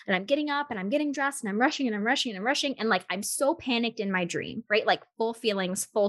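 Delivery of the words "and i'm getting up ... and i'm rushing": fast, breathless retelling; rushed. Retold or imitated. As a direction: rattle it off in a hurry.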